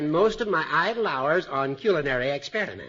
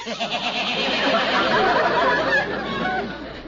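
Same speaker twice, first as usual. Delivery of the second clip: a long, high, louder snicker; high snickering laugh; 6 dB louder than usual. Acted. Judge laughs at his own dinner joke, a high snicker.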